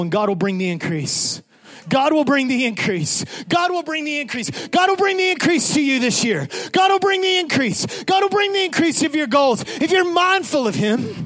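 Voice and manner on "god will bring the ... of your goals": building proclamation. Acted. Preacher proclaiming increase to the congregation, building to a shout. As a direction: build each repeat bigger until you shout.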